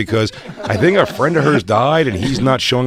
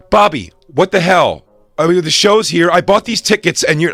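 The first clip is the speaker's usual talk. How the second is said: louder, higher mock-angry scolding; fake angry outburst; 6 semitones higher, 4 dB louder than usual. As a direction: pick a staged fight with your buddy. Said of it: "I just start a fight with Bobby".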